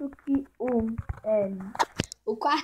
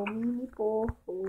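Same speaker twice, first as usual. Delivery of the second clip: slow, savoring the words; drawn out; much slower than usual. Shown as a demonstration. Naming the piglet, slowly drawn out. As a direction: stretch out mini porco fondly. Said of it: "repeats the name slowly".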